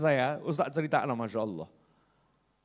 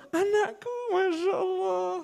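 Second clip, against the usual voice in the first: much higher, softer, cooing father on a video call; doting on his child; 5 dB quieter than usual. Retold or imitated. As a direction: coo at the little one like a proud dad. The patient one doting on child. (ayah yang menelepon anaknya dengan suara tinggi dan lembut)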